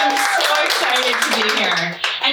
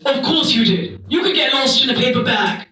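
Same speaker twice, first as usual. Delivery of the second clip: put-on disdainful old-timey accent; Charlotte. Hostess scorns mom for getting lost, old-timey disdain. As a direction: belittle them in an R-less old-timey accent. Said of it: "And Charlotte says".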